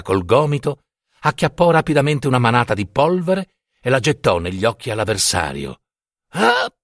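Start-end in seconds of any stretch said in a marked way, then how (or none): 6.3-6.7 grido improvviso molto acuto, accecato; urlo acuto di dolore. A narrator's reading. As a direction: urla come chi riceve la polvere negli occhi. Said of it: "urlò Turiddu accecato". (Turiddu accecato lancia un urlo acutissimo)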